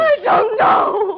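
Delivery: slow, high, frightened wail; terrified cry. Acted. Woman at gunpoint crying out to the villain in terror. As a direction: plead in panic with the gun on you.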